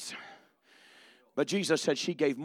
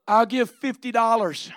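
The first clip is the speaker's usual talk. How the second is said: loud pledge; 5 semitones higher, 10 dB louder than usual. Acted. Preacher acts out a giver.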